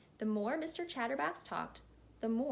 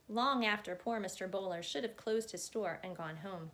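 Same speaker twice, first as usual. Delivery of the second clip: usual speaking voice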